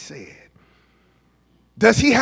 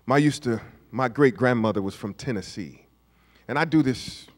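ordinary delivery

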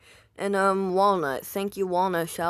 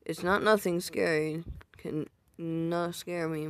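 ordinary delivery

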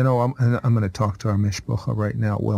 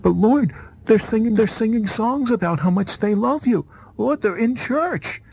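a much higher, pleading woman's voice; pleading wife; 10 semitones higher than usual. Retold or imitated. Husband voicing his wife pleading with Jesus, high and earnest.

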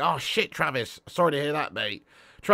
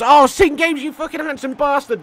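delighted thanks; 8 semitones higher, 5 dB louder than usual. Real, not acted. Thanking a donor, overjoyed.

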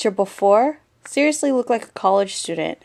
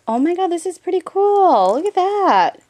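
high, loud, swooping excitement; real delighted outburst; excited amazement; 9 semitones higher, 8 dB louder than usual. Real, not acted. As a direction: exclaim in thrilled amazement. Amazed by album page detail.

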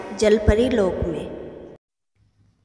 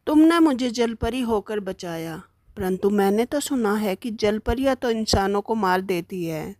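lightly voiced young prince; 3 semitones lower than usual. Rescued prince speaks to mermaid.

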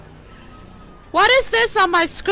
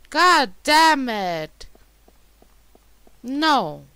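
ordinary talk

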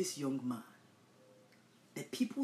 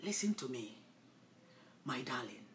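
soft, low, breathy endearment; comforting whisper to the congregation; tender whisper; 6 semitones lower, 7 dB quieter than usual. Real, not acted. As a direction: draw the listener close gently. Tender turn to listener.

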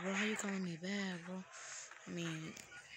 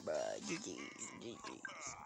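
much lower, gruff mock voice; deep mock voice; 14 semitones lower, 6 dB quieter than usual. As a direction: drop into a cartoonish deep voice. Deep put-on voice.

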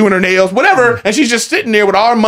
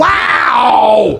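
mock amazed wow; 4 semitones higher than usual. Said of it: "I was like".

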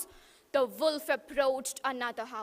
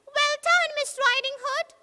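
much higher, louder yell; cheering little pig; 11 semitones higher, 4 dB louder than usual. Acted. Pig yelling thanks from the window, high and loud. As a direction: cheer it out like a happy piglet. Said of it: "stood and yelled".

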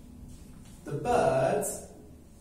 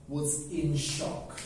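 ordinary delivery

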